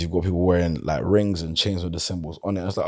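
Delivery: ordinary talk, nothing marked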